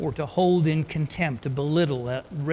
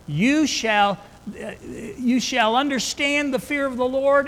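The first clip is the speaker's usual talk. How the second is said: much higher and louder, slowing on the promises; preaching at full force; 5 semitones higher, 4 dB louder than usual. Acted. Preacher urging the church to seek wisdom, at full force. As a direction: build to a passionate sermon climax.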